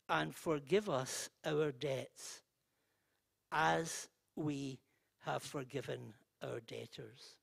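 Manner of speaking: prayer. Real, not acted. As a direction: lead the room in prayer. Leads the Lord's Prayer.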